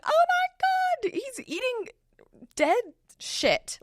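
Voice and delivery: very high, drawn-out retold shriek; mock horrified scream. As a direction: imitate screaming bloody murder, without full volume. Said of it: "like, screaming bloody murder".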